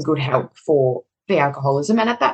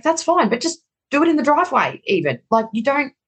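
exasperated plea; 9 semitones higher than usual. Retold or imitated. Pleading with husband about curfew.

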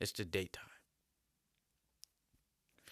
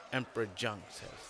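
usual speaking voice

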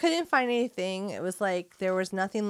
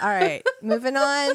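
laughing; 5 semitones higher, 5 dB louder than usual. Real, not acted. Laughing it off loudly.

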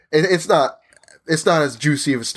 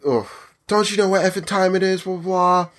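higher mock yell of an irate customer; imitating angry yell; 7 semitones higher than usual. Retelling the customer yelling about closing time, higher mock rant. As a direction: play the furious shopper, pitched up. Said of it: "starts screaming at me".

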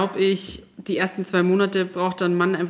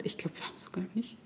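whispered private aside; 15 dB quieter, faster than usual. Real, not acted. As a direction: drop to a secret whisper.

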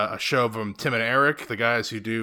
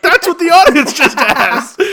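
loud, high and laughing; delighted exclamation; 12 dB louder, slower than usual. Real, not acted. Host agrees with Claudette for the audience, gleeful shouting. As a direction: crow it slowly, cracking up.

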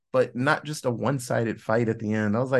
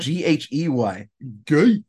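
mock alpha male; 4 dB louder than usual. Co-host spells out the slur, loud mock alpha male. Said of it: "alpha male impression".

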